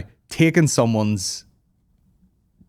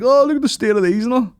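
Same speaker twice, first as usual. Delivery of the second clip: high mocking voice; mock commenter; 13 semitones higher, 5 dB louder than usual. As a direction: sneer like online commenters. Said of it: "everyone's like".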